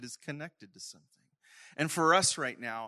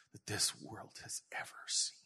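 whispered; 8 dB quieter than usual. Retold or imitated. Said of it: "he says".

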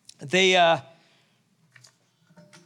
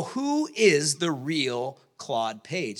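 slow puzzled question; 3 semitones lower, much slower than usual. Retold or imitated. Panelists wonder aloud.

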